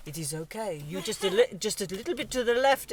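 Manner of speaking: light imitation French accent. Imitating a colleague guiding the nervous driver, French accent. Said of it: "with this French accent going".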